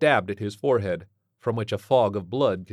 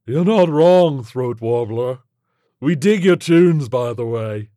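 loud, punchy alien voice; breezy alien creature; 4 semitones higher, 11 dB louder, slower than usual. Acted. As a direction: pay a brash, chummy compliment.

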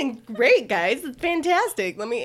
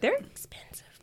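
whispering; 14 semitones higher, 6 dB quieter than usual. Real, not acted. Joking aside about expensive mats.

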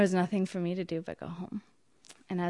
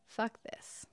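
muttered curse; 12 dB quieter than usual. Retold or imitated. Inner outburst at being condemned.

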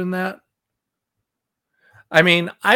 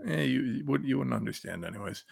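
dismissive mutter; 3 semitones lower, 10 dB quieter than usual. Real, not acted. Dismissive aside.

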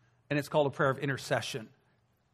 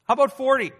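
much higher, louder anxious bargaining; pleading Abraham; 10 semitones higher, 8 dB louder, faster than usual. Retold or imitated. Abraham bargaining with God for the city, anxious. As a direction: haggle nervously with God. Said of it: "And then Abraham goes".

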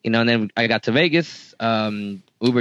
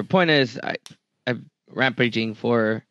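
ordinary talk, nothing marked

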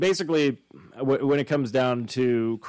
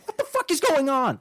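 much higher, baffled outburst; bewildered guy; 12 semitones higher than usual. Pretend. Burglar confused by the cops' questions, in high disbelief. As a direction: squeak out total confusion.

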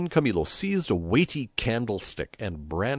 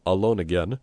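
plain reading voice

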